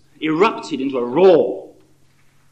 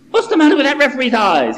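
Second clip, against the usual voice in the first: higher, louder fan's outrage; indignant demand; 10 semitones higher, 8 dB louder than usual. Acted. Fan John yelling at the referee on the screen, outraged. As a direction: demand an answer from the telly. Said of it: "he demanded".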